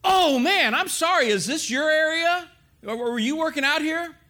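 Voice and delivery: high-pitched, apologetic blurting; startled apology. Retold or imitated. Gym-goer to growling stranger, startled apology.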